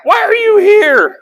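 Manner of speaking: very high, loud, drawn-out mock yell; exasperated shout. Older brother scolding young David at battle, exasperated mock shout. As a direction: holler it slowly in hammed-up disbelief.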